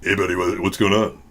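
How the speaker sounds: deep natural voice. Shown as a demonstration. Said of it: "I have a big range".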